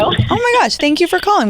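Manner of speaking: bright high-pitched gush of welcome; excited greeting. Real, not acted. Radio host greeting a caller, excited and high.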